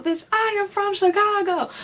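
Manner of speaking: high, sassy drag queen threat; Chicago drag queen. Retold or imitated. Quoting a drag queen's threat, high and sassy. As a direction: throw the high diva threat at her. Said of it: "about to pop her Chicago style".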